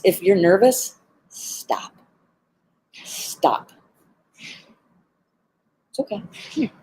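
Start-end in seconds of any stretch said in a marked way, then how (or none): 1.3-3.7 breathy, hushed stop; whispered stop. Shown as a demonstration. Trainer showing the advice with a hushed stop. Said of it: "key piece of advice". 5.9-6.7 softer and higher, coaxing a bird. Real, not acted. Trainer coaxing the cockatoo to come up, soft and high.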